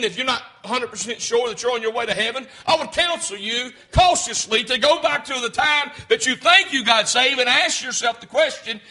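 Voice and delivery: ordinary talk, nothing marked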